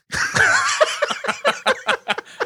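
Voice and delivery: high-pitched squeal of laughter. Real, not acted. Laughs at Les Mis pick.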